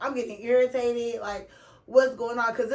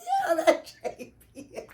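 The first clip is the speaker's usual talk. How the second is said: higher, giggling quote of herself; laughing through the line; 5 semitones higher than usual. Retold or imitated. Teller quoting herself to the nurse, laughing through it. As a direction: say it while cracking up.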